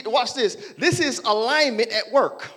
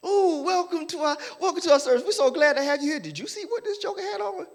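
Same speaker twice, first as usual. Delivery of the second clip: fake sweet greeter; 6 semitones higher than usual. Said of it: "Smiling at the door".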